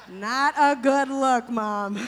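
laughing, loud and slow. Real, not acted. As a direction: tease her through laughter. Teasing mom, laughing.

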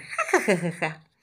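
happy tickled giggle; giggling. A narrator's reading. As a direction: laugh as if being tickled. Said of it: "ruiditos de felicidad".

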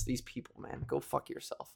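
unvoiced whisper aside; whispered curse. Real, not acted. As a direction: mutter it under your breath.

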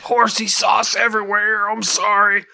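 loud, high, hammed-up cry; mock outcry. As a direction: blurt it at full volume as a joke. Joins the ad parody, crying out.

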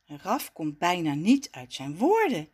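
plain reading voice

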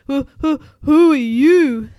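loud, high, stuttering fright; stammer, then stretch out the question in fear; scared storybook stammer; frightened stutter. A narrator's reading. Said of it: "stuttered Peso".

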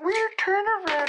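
falsetto squeak, far up the range; hammed-up falsetto parody; high squeaky mock voice. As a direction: mimic someone in a tiny high voice. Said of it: "he said".

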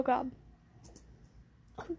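usual speaking voice

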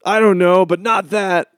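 loud, high mocking whine; mock naysayer. Friend mocking critics in a loud smug voice. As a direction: spit it back like a smug critic.

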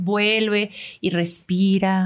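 very slow, calm guided-breathing delivery; slow meditation voice. Shown as a demonstration. Showing the producer how she had to pace the meditation audio, slow and calm. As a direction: ease each word out as if guiding a breath. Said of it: "ir súper pausada".

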